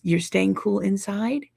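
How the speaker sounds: ordinary delivery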